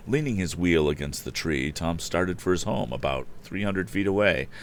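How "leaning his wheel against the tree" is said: ordinary delivery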